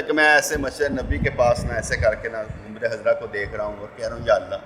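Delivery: low, subdued murmur fading away; spiritual constriction. Acted. Preacher recalls praying near the Prophet's tomb, subdued and low. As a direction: let the voice sink, soft and heavy-hearted. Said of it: "اور ایک قبض کی حالت ہے".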